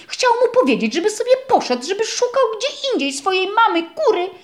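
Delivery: ordinary delivery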